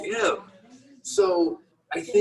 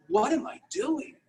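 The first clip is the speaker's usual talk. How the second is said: self-reproach; 7 semitones higher than usual. Retold or imitated. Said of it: "I caught myself".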